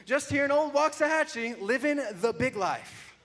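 high, loud mock-proud read; reading his old post in a mock-proud voice. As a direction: announce it like a proud boast. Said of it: "it says".

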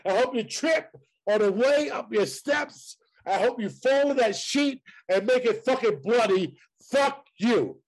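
high, indignant rant at a co-host; heated cursing. Real, not acted. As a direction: lay a curse on them, pitched well up.

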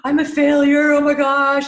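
high, loud, panicked inner voice of failure; panicky self-doubt. Retold or imitated. Guest voicing the collapsing mind, panicked and loud. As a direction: let the doubt spiral into alarm. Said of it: "When I say collapse, it's like".

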